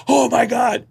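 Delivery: high, thrilled gasp of disbelief; overjoyed fan. Retold or imitated. Fans thrilled at front row.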